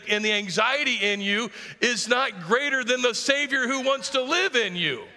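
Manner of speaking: rising, fervent preacher's build; preaching at full force. Acted.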